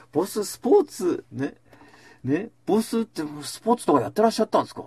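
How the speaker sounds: fawning question. Retold or imitated. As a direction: flatter the boss with a keen high question. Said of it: "ってこう言うわけ".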